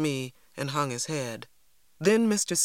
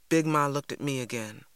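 usual speaking voice

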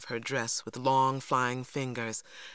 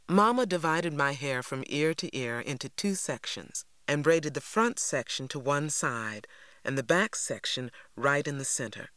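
usual speaking voice

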